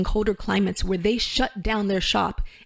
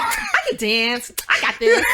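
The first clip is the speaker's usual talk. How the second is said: cocky; 4 dB louder than usual. Retold or imitated. Retelling her audition-day dancing to the host, mock cocky. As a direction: mimic your cocky younger self. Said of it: "I was cocky".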